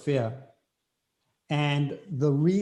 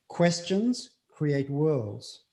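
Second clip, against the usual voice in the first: slow emphasis; much slower than usual. Shown as a demonstration. Repeats quote slowly for emphasis.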